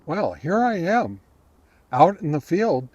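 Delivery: ordinary talk, nothing marked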